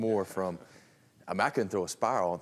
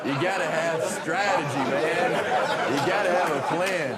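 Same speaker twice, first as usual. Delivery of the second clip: higher, louder hammed-up coach sermon, laughing; mock coach pep talk; 8 semitones higher, 4 dB louder than usual. Player imitates coach lecturing linemen on strategy, hammed-up loud. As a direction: preach it like the coach after Home Alone.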